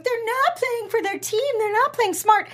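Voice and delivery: high-pitched mock of everyone's griping; high, whiny mimicry of the crowd; mocking complaint. Mocking what everyone keeps saying.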